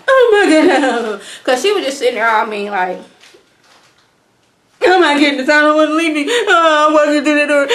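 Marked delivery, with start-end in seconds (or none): 0.1-1.2 loud, drawn-out mock wail; worst fake cry. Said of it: "the worst cry in, like, history". 4.8-7.7 hammed-up mock bawling, high and loud; bad fake cry. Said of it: "it was so bad and a bad cry".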